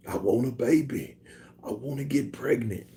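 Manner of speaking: soft, hushed impression voice; Mike Tyson. Imagining the boxer wishing for a baby, soft impersonation. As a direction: say it softly as a comic celebrity impression. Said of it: "Imagine Mike Tyson saying".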